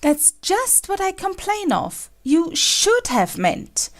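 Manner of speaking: impatient queen. A narrator's reading. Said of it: "interrupted her impatiently".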